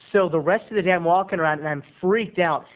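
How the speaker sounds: usual speaking voice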